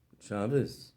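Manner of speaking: low, soft, gloomy good Shabbos; glum reply. Acted. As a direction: mumble it like nothing is good. Sad man returns greeting glumly.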